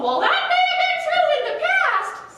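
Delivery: very high, loud bird voice, laughing through; high screechy albatross. A narrator's reading. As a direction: screech it with a giggle. Albatross voice in story.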